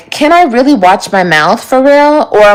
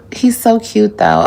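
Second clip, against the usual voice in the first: sighing fondly; 6 dB quieter than usual. Real, not acted. Sighs fondly about her man.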